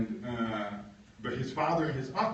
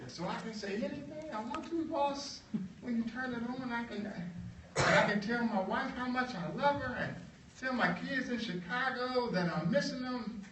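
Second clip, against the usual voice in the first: meek worker; 12 semitones higher than usual. Retold or imitated. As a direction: play him humble and wide-eyed. John voiced high and meek.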